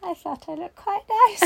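high, put-on wounded voice; mock hurt protest. Student replies to the teacher's insult, high mock hurt protest. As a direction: whine it as a playful protest.

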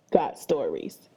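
got stories dropped way low and flat; deep deadpan drop. Acted. Host mocking apps copying stories, deep deadpan drop.

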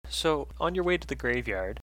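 ordinary talk

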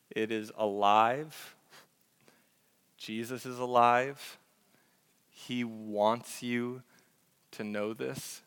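usual speaking voice

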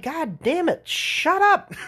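high, strained mock exasperation; exasperated outburst. Fed-up expert to nagging customer, comic exasperation. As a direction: blow up in comic frustration, pitch climbing.

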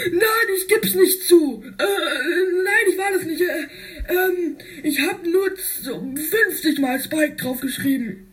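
high whiny refusal with a laugh; stubborn whiny kid. Acted. Spike refusing to confess, high whiny voice. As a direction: dig in like a sulky child.

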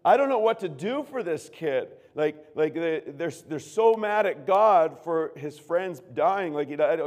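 put-on upset young pastor asking for help; distressed plea. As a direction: pour out your worry to the pastor. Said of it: "and I said, I said".

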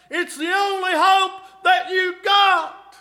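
loud, high, rising preacher's cry to the flock; full-force preaching. Acted. Preacher calling to the congregation about hope, full-force preaching. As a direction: preach it out, pleading and building.

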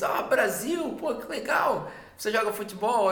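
light up in a high, eager voice; acted delight. Customer learns the caller is Brazilian, acted excitement.

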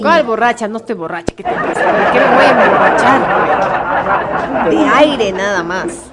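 loud, laughing protest; laughing denial. Real, not acted. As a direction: insist you're sober while laughing. Denies being drunk, laughing.

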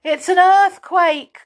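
high, loud alarmed cry; frightened cry. Acted. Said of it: "cried Franny".

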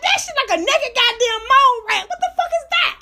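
high, laughing mockery; mock it while cracking up; laughing disbelief. Retold mocking reaction.

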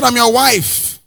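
high mock scolding wife; a preacher voicing a wife scolding her husband; nagging wife. Pastor playing a wife, mock scolding. Pastor repeats wife's line.

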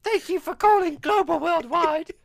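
high, loud call-centre greeting; loud, high mock receptionist; call center voice. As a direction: chirp it out like a phone operator.

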